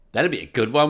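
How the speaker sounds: normal talking voice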